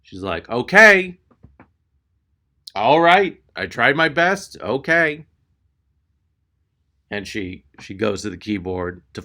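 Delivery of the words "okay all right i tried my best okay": high, drawn-out mock giving up; singsong washing of hands. Clerk done trying to sell insurance, mock ominous singsong. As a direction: imitate a clerk warning by giving up.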